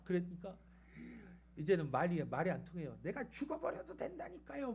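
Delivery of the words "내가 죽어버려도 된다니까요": high, squeezed mock tantrum; hysterical fit, imitated. Jonah flips out at God, insisting on death. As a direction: protest in a high voice, held back in volume. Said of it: "막 뒤집어집니다".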